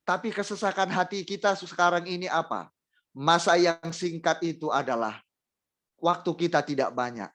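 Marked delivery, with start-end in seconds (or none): none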